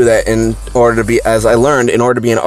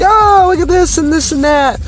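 much higher, gushing mock teacher. Teacher cooing over the class's paintings, much higher. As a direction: coo over every picture like a sweet teacher.

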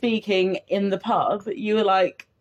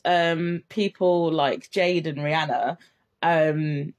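ordinary talk

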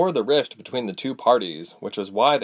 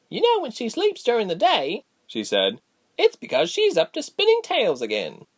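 put-on much higher, light young voice; high girlish voice; 13 semitones higher than usual. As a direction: chatter like a young girl. Gilly's voice.

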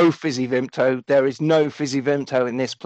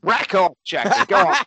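mock Aussie put-down; 3 semitones higher than usual. Repeating the Australian put-down.

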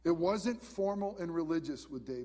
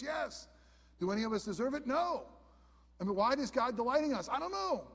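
emphatic answers; 7 semitones higher than usual. Real, not acted. Preacher answering own questions.

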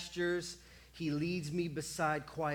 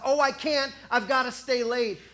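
hurried excuse; 5 semitones higher, 8 dB louder than usual. Acted. Busy excuse.

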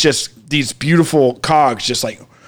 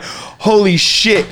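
slow, drawn-out amazement; awed; 4 semitones higher, much slower than usual. Retold or imitated. Seeing the stars turn on, slow and awed. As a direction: stretch it out in wonder.

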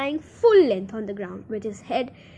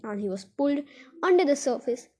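ordinary delivery

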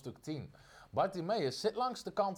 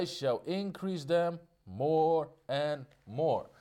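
solemn scripture reading; much slower than usual. Acted. Scripture quoted slowly in English.

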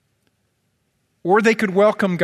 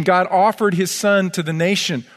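usual speaking voice

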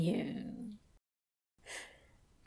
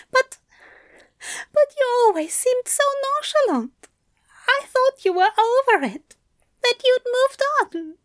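high, fast, hesitant and tearful; tentative after crying; 14 semitones higher, 4 dB louder, faster than usual. A narrator's reading. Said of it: "sobs under control, tentatively trying to poke holes".

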